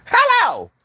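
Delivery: very high-pitched little call; comic hello. Pretend. Calling out for a laugh, comic high hello. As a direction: pipe it up in a silly high voice.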